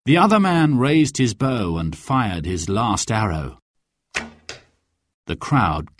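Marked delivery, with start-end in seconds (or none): none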